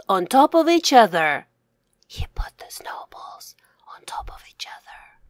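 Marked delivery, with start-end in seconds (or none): 2.1-5.2 whispered repeat. Shown as a demonstration. Author whispering the sentence back for young readers. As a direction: say it again in a whisper. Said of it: "repeat, then whispered".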